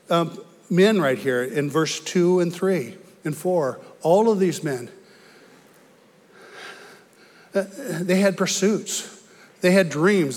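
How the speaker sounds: loud, high-pitched preaching push; preaching at full force. Acted. Preacher driving the fishing story home to the congregation, fervent and loud. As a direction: preach it out with rising fervor.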